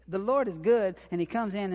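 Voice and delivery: ordinary delivery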